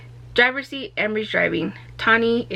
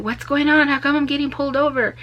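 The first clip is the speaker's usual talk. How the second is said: confused alarm; 7 semitones higher, 3 dB louder than usual. Retold or imitated. Driver pulled over, confused.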